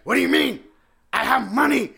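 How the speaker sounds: loud, rising indignant protest; mock outraged yell. Customer yelling at the cashier who refused him. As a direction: shout it like you can't believe it.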